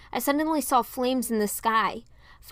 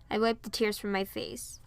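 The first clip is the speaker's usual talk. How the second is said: ordinary delivery, nothing marked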